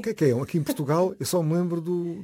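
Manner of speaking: ordinary talk, nothing marked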